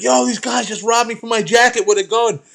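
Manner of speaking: put-on a robbed kid begging for help; frantic kid. Robbed kid pleading with cops, high and frantic.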